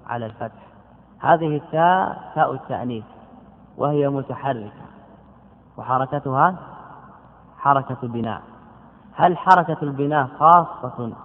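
usual speaking voice

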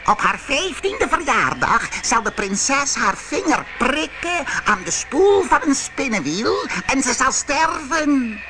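high, rising wicked witch curse. Acted. Wicked fairy curses the princess.

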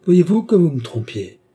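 high, loud outcry of reproach; alarmed cry. A narrator's reading. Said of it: "cria Philippe".

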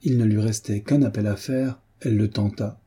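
ordinary delivery

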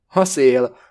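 loud, mildly scoffing dismissal; short laugh. A narrator's reading. Blavatsky brushes off her account, curtly amused. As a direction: dismiss it loudly with curt amusement. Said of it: "mondja Blavatsky kurta nevetéssel".

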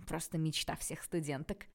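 usual speaking voice

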